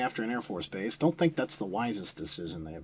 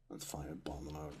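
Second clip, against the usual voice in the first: muttered order; 6 semitones lower, 14 dB quieter than usual. Real, not acted.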